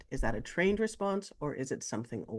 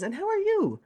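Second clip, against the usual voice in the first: high, sing-song check-in; caring inquiry. Retold or imitated. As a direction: ask how they are, pitched way up. Somebody asking a nurse, acted.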